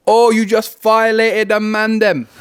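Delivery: high, loud, slow mock English roast; mock English slang. Host acts out a roast to a friend, mock English accent. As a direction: ham up a London lad's comeback. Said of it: "I feel like it's an English thing".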